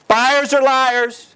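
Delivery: slow, high, mocking sing-song; mocking quote. Speaker mocks the saying agents repeat, drawn out. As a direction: drawl it out like a tired old cliché.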